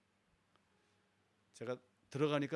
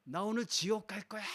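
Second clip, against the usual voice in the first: possessed sister; 7 semitones higher, 5 dB louder than usual. Retold or imitated. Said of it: "그래요".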